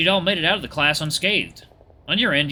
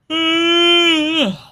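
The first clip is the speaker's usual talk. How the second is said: long, high, loud exasperated cry; drawn-out high wail of frustration; exasperated groan; 9 dB louder than usual. Acted. Friend stranded with a flat tire, exasperated groan. Acted reaction to Luke's retort.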